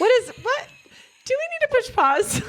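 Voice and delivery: high startled yelp; surprised. Real, not acted. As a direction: squeak out in surprise. Startled mid-recording.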